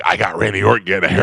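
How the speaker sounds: quick, mildly hyped-up brag; mock gloating. Shown as a demonstration. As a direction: imitate an eager fan showing off a catch. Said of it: "Not like".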